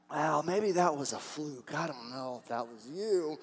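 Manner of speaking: slow, low, doubtful musing; tentative Gideon. Acted. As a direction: drop low and soft, dragging out the doubt. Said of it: "Gideon was so kind of tentative".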